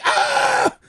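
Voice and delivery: very high mock shriek of terror; hammed-up high scream. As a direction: yelp like you just saw a ghost, half in jest. Retelling hero seeing the ghost.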